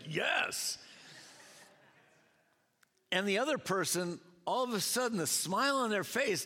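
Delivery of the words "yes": mock cheer. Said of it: "Bob over here is like".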